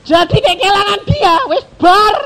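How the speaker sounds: high and loud, half laughing, half crying. Real, not acted. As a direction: laugh through tears about losing her. Laughing cry over losing wife. (tertawa sambil menangis, tinggi dan keras)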